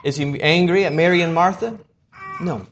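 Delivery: ordinary delivery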